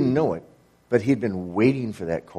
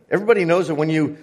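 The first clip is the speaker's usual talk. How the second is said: normal talking voice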